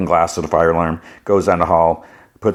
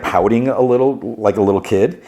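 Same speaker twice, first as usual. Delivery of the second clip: ordinary talk, nothing marked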